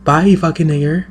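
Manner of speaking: high mock impression of people smiling then insulting. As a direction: sneer it in an exaggerated put-on polite tone. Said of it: "That is basically what they're saying".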